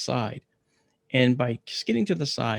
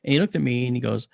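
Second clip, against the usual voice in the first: plain reading voice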